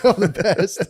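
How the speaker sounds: a fighter's cereal boast dissolving into laughter; laughing mock boast. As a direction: crack up while bragging.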